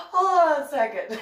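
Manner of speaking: words squeezed out through laughter; laughing. Real, not acted. Begging for a pause while laughing hard. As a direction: try to talk while cracking up.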